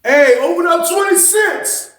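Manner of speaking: high, slow call across the tier; drawn-out call to the booth. Retold or imitated. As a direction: holler up to the officer to open the door. Calling out for door opened.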